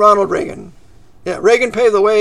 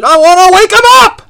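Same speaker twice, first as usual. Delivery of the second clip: imitated preacher's rousing holler; high, very loud retort in a preacher's yell; 10 semitones higher, 11 dB louder than usual. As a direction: holler it as if waking the whole church. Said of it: "yell like that?".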